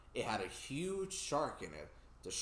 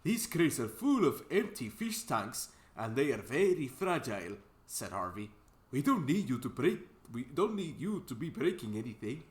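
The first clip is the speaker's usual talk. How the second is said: put-on Scottish accent; 5 dB louder than usual. Harvey warning Thomas off the crates, Scottish accent. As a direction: caution him in a Scottish accent. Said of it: "I'm giving him a Scottish accent".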